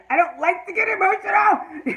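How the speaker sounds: high, loud wail breaking into a laugh; loud, high-pitched emotional protest; emotional outburst. Real, not acted. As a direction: cry it out, half laughing at yourself. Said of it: "I'm sorry I got emotional".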